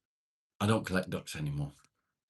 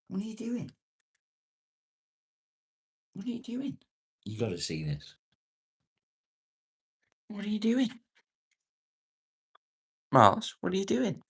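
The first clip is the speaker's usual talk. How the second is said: very high sing-song pet voice; 14 semitones higher than usual. Real, not acted. Owner coos at his dog Miles, high baby talk. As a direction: coo at the dog in a squeaky high voice.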